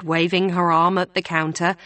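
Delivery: ordinary delivery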